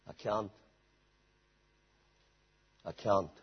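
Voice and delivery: low, hushed admission of inability; sunken, resigned little voice; defeated. Acted. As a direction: mimic a believer giving up, softly.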